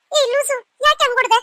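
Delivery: loud, high-pitched protest; indignant yelp. Acted. Friend protests at being suddenly hit, indignant. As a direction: snap back loudly in indignation.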